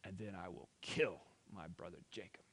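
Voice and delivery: low, hushed acted threat; soft, menacing undertone; scheming. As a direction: plot it quietly to yourself. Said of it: "Esau began to scheme".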